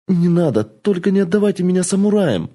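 desperate pleading. Acted. Girl begs in panic.

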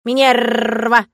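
loud, slow caw with long rolled r; drawn-out cawing jeer; mocking raven croak. A narrator's reading. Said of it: "насмешливо прокаркал с её плеча фамильяр ворон".

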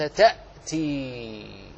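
يأتي drawn out slowly, syllable by syllable; slow model word. Shown as a demonstration. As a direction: dictate يأتي very slowly and low. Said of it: "وإذا خاطبته قلت".

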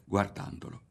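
plain reading voice

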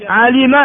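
علم as 'alima; original form. Shown as a demonstration. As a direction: say علم with a, then i. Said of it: "علم فيه ثلاث لغات". (علم بفتح العين وكسر اللام)

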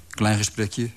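usual speaking voice

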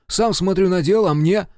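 loud, high-pitched indignant reproach; cutting retort. A narrator's reading. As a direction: reproach him with rising indignation. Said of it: "срезал Серёжа". (возмущённо упрекать)

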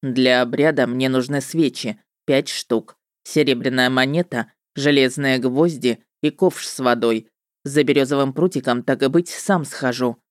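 low-pitched male character voice; businesslike witcher. A narrator's reading. Witcher lists what he needs.

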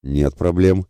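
grudging, unfriendly mumble; hostile mumble. A narrator's reading. Калаяр backing down before Ратибор, hostile mumbling. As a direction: concede it with dislike. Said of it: "неприязненно пробубнил Калаяр".

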